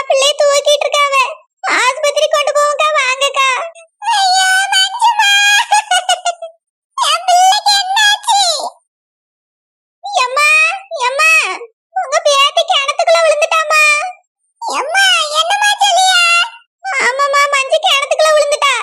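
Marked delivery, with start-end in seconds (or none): 4.1-8.4 slow, high-pitched sobbing cry; anguished wail. Acted. Mother crying for her daughter Manju, wailing. 14.6-16.2 high, alarmed outcry; shocked cry. Acted. Grandmother told her grandchild fell, horrified. Grandmother hears the terrible news.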